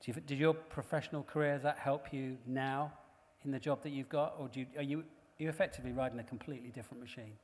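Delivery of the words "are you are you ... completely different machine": normal talking voice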